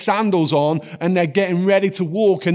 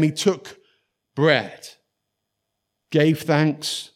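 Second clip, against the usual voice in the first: ordinary delivery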